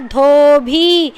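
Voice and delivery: Dhobi stretched very slowly, with a breathy dh; slowly drawn out. Shown as a demonstration. Teacher repeats the word slowly for pupils to copy. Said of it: "क्या बना".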